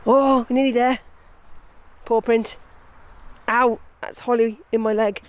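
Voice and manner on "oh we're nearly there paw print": ordinary talk, nothing marked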